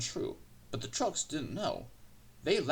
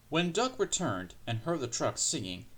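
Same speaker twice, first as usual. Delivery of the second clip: ordinary delivery, nothing marked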